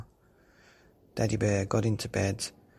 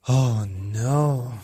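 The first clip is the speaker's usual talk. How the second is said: loud fed-up oh no; exasperated cry; 9 dB louder than usual. Acted. Daddy Bear kept awake by snoring, loud exasperated cry. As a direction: groan it out, fed up. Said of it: "said Daddy Bear".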